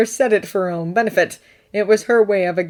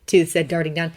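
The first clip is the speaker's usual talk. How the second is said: ordinary delivery, nothing marked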